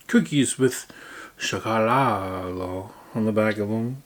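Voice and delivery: ordinary delivery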